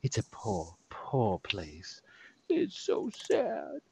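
hushed, low, mock-sorrowful; pitying character voice. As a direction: confide it softly with theatrical sadness. Game master voices character pitying Merc.

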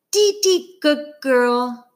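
put-on high little-girl voice, proud; lisping toddler praise. A narrator's reading. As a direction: chirp it like a tiny child. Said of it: "she tells Dad".

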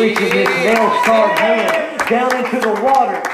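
high, loud preacher's cry; fervent altar-call preaching; impassioned preaching. Acted. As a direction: lift them up with urgency. Preaching to the congregation.

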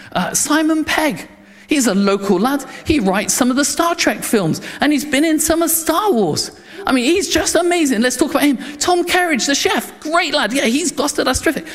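a dignitary gushing about famous locals, excited. Acted. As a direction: pitch it up like a thrilled host boasting. Excited dignitary listing local heroes.

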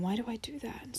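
unvoiced whispered self-question; a soft inward whisper. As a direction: ask yourself quietly inside. Inner self-questioning voiced softly.

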